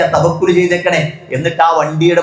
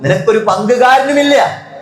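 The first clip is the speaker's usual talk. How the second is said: raised, high preaching cry; preaching shout; 6 semitones higher than usual. Real, not acted. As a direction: cry it out to the congregation. Preacher stresses no partner.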